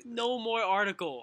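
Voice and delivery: slow, loud, high refusal; exasperated plea. Real, not acted. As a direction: plead it slowly over them. Repeats the plea over co-host.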